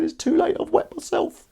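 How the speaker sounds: very high mock-wailing voice; exaggerated squeaky imitation sob; crying newcomer. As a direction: squeal it like a tearful child. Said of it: "I found him crying in the corner".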